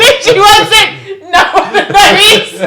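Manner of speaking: shrieky, loud real laughing denial; laughing protest. Real, not acted. Co-host defends missed swear words, high loud laughing protest. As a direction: insist helplessly through laughter.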